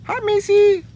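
high, loud sing-song hello to a pet; excited pet greeting. Real, not acted. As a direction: greet the animal brightly. Greeting the animal.

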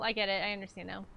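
ordinary talk, nothing marked

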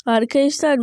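usual speaking voice

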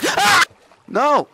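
very high, loud panicked cries; panicked screams. Real, not acted. Attacked in cave.

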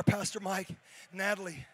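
ordinary talk

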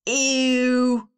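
loud flat ew; big kid grossed out; disgusted boy. Acted. Biggest boy to Leon, loud disgust. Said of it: "said the biggest boy".

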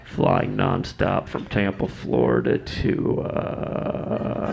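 low, slow, loud bored drawl. Retold or imitated. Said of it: "he always sounds like a really bored redneck".

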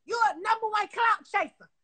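normal talking voice

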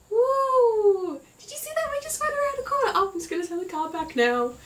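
high, hammed-up carefree dad; carefree dad cheering round a bend; mock dad. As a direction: play the reckless dad who thinks it's fun. Dad driving casually round corners.

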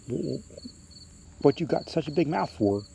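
put-on a touch of nervous boy; mildly frightened boy. A narrator's reading. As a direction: pause after the first word, then ask timidly. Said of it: "so frightened he can't move".

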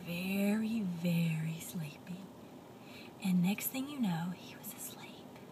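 hushed whisper as he drifts off; soft bedtime whisper; sleepy whisper. A narrator's reading. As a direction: whisper so you don't wake him. Pigeon falls asleep.

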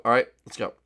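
usual speaking voice

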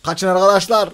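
loud, high warning call; panicked shout; alarmed shout. Real, not acted. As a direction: yell at the others to run. Warning teammates Jason comes.

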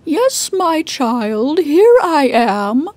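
slow, stretched-out disguised wolf; the wolf faking a granny voice, slowly; strange voice. Acted. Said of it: "she heard a strange voice reply".

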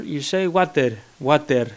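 water in a Greek accent, said watther with a clear R. Shown as a demonstration. Greek accent, mocking his own language's water. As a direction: say water as a Greek, every letter sounded. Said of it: "you pronounce every letter".

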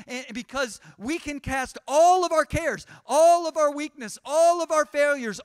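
rising, loud preacher's appeal; impassioned preaching. Acted. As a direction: build the call to draw near. Preaching at full force.

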